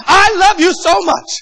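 high, loud fervent declaration; fervent cry. Acted. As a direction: pour out devotion to God. Abraham's fervent declaration to God.

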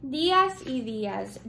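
usual speaking voice

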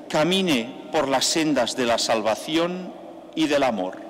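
ordinary talk, nothing marked

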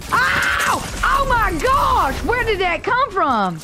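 high, drawn-out shocked outcry; startled yelp. Real, not acted. Player hit from nowhere, startled yelp. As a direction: yelp high, then slow in disbelief.

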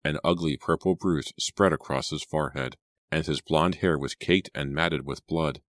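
ordinary delivery, nothing marked

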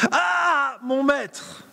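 high, loud anguished shout; loud acted cry. Disciple shouts to his master Élisée in dismay. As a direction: belt it out like the panicked disciple. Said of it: "Je le joue parce que c'est vraiment ce qu'il dit ici".

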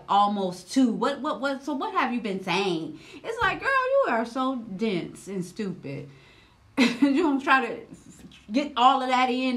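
normal talking voice

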